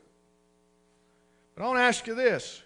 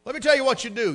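usual speaking voice

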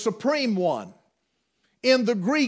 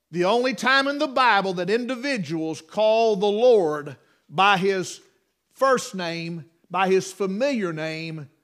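normal talking voice

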